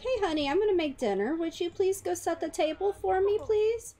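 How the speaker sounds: high, fast sweet-mom request. Pretend. Mom asking her son to set the table, high and quick. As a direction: ask sweetly like a mom, rushing it. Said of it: "Be like".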